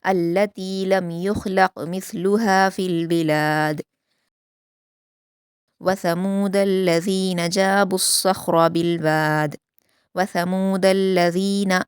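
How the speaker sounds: slow, melodic Quranic recitation; measured Quran recitation; tajweed recitation. Shown as a demonstration. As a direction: recite it slowly and carefully as a model for students. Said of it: "दाल पर कलकला करेंगे".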